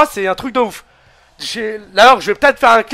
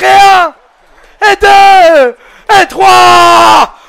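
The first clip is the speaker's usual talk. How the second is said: loud, excited wrestling commentary, often higher and louder; hyped French wrestling commentator calling every move; live play-by-play; 5 semitones higher, 4 dB louder, much slower than usual. Acted. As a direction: call the match like a ringside announcer.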